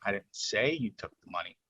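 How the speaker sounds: "say" lifted above the other words; stress on SAY. Shown as a demonstration. Presenter showing the difference, stress on "say". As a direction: hit "say" to imply an accusation. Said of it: "Do you see the difference?".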